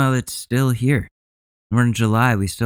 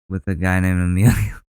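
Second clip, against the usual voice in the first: usual speaking voice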